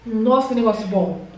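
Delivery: speaking between bites; chewing while talking. Real, not acted. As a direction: mouth partly full of dessert. Seen 4: Woman ate a spoonful at -7; cheeks and lips chewing while speaking.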